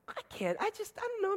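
a man caught off guard, fumbling; flustered hesitation. Retold or imitated.

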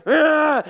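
high, loud frightened shriek; a little girl shrieking in a dark cellar; child's scream. Retold or imitated.

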